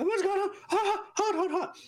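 high-pitched mock panic, like a child; hammed-up kid panicking over a burning mouth; imitated freaking out. Dad imitating his daughter after spicy wings, mock freaking out. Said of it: "like, freaking out".

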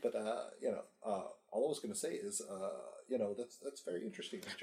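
talking from across the room; far from mic. Real, not acted. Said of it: "you sound like you're far away".

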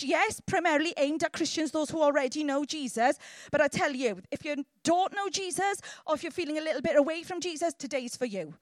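normal talking voice

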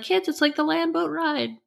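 lightly acted high call to the kids; excited mom. Mom showing her kids a farmhouse, high-pitched excitement.

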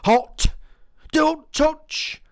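mock shrill caution at the counter; warning voice. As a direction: squeal it like a warning.

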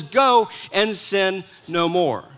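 slow, weighty command; slow and measured. Shown as a demonstration. Preacher giving Jesus' command to the woman, slow and weighty. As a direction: let each word land slowly. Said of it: "get the emphasis on the right syllable".

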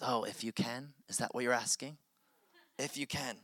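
soft, gently questioning repeat; questioning echo. Acted. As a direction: quietly hand his question back to him. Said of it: "he's just repeating back to him".

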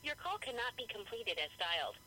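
ordinary delivery

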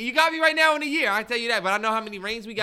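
fired up against his friend's jabs; heated shout. Real, not acted. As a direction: yell back in self-defence. Defending in heated argument.

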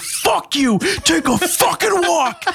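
high, slowed yelling right in his face; furious shout. Retold or imitated. Retelling screaming at the drunk guy trying to open the car door. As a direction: scream at him to take a walk. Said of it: "yelled at somebody like this".

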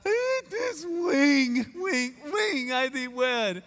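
high, slow, drawn-out weepy wail, "ring" turned to "wing"; hammed-up crying with a w for the r in "ring"; mock sobbed vows. Groom saying his ring vow to his bride, comically tearful. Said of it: "and it comes out".

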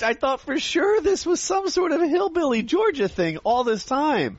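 high-pitched, stunned disbelief; real shock. Real, not acted. Host who thought it was a hillbilly thing, shocked. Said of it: "I'm so shocked".

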